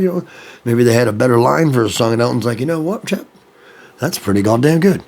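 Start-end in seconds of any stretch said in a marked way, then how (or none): 2.6-5.0 a weak, lightly put-on English accent; a self-admittedly bad English accent, higher in places. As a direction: do a half-hearted English accent, playing the gracious star. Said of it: "my English accent's not good".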